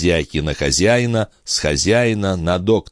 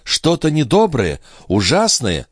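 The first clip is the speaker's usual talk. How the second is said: higher-pitched, worried questions; alarmed questioning; 8 semitones higher than usual. Acted. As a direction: ask with rising dread, pitched up. Mother fears bad news. (тревожные расспросы повышенным голосом)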